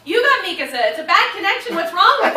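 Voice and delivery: loud, high exasperated shout; exasperated friend yelling. Retold or imitated. Director exasperated on phone.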